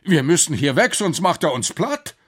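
alarmed high, loud warning; urgent call. Acted. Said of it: "rief der Professor aus".

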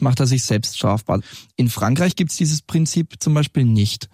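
ordinary talk, nothing marked

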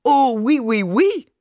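hammed-up French queen; mock queen. A narrator's reading. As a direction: play an affected royal greeting the crowd. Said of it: "wie eine Königin".

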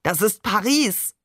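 slow cry building to a high peak; enthusiastic call. A narrator's reading. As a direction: proclaim it with rising excitement. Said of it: "rief Babe".

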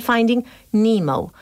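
"Finding Nemo" with nee-moh; "Nemo" as nee-mow, English vowels; English pronunciation. Shown as a demonstration. Teacher demos the English "Finding Nemo", nee-mow. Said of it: "Y dicen".

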